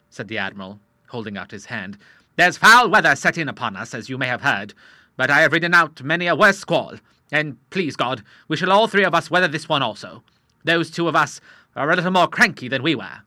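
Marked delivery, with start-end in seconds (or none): 2.4-13.2 louder, hearty seaman's voice; bluff old sailor. Acted. Old admiral greeting the doctor, loud and hearty.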